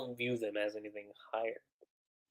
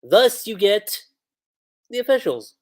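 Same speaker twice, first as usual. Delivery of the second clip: usual speaking voice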